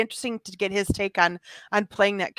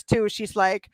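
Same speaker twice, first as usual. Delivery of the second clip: ordinary talk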